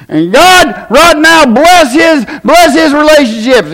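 high-pitched, fervent praying preacher; imitated altar prayer. Altar minister praying over a man with a headache, imitated fervent prayer. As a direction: pray over him like an earnest altar minister. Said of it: "the guy was up there, and he goes".

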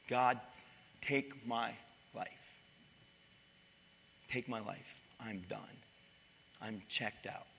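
soft, sinking voice of despair; despairing Elijah. Retold or imitated. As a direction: let each phrase fall away, defeated. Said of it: "He goes so far to say".

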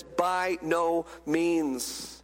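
weighty, slowed "By no means"; emphatic refusal. Acted. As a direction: spell out "By no means" word by word. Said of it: "Paul emphatically says".

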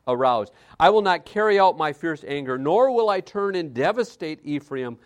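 loud, fervent preaching of God's words; booming preaching. Acted. Preacher voicing God to the congregation, booming. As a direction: thunder it out from the pulpit.

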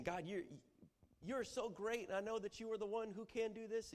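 butter God up; flattering appeal. Acted. Preacher acting a believer flattering God. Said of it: "you appeal to God's character".

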